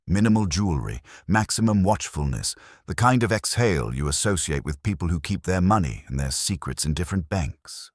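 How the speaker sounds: ordinary delivery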